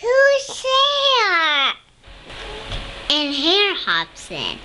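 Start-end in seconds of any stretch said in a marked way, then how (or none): 0.0-1.7 high frightened little squeak; squeaky mouse. Acted. Mouse calling into the dark den, high squeak. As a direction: call out like a startled tiny mouse. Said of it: "Mouse cries".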